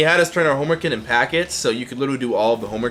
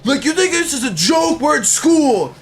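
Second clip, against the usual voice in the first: mock furious yell; 4 dB louder than usual. Teacher flips desk, yells.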